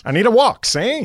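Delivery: thirties gangster talk. Retold or imitated. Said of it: "that's Ron's voice".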